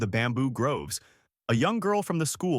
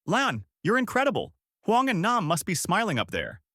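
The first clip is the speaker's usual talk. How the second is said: higher-pitched, restrained yell; mild excited yell; 8 semitones higher than usual. A narrator's reading. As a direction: call it out high without real volume. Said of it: "Uncle Tu yelling".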